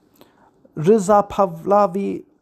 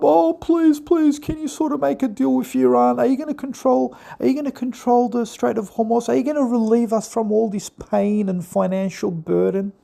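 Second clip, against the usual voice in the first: higher, simpering plea from the Western media; whiny pleading; 5 semitones higher than usual. Retold or imitated. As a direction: beg like a desperate supplicant. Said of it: "they're pleading with Trump".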